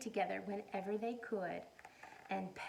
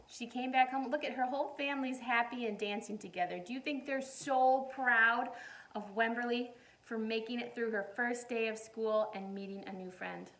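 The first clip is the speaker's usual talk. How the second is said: usual speaking voice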